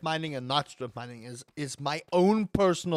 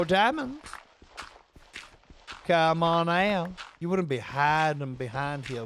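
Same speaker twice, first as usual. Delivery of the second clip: exaggerated American accent; 3 semitones lower than usual. Streamer calling out to diamonds hiding behind gravel, exaggerated American Southern accent. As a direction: coax teasingly in a put-on Southern accent. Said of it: "this is the most offensive accent I have ever done".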